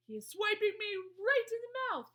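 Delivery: high, loud outraged shout; yell. A narrator's reading. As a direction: yell it like an injured giant. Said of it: "yelled the meat dripper".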